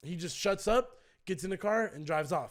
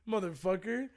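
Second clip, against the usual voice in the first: usual speaking voice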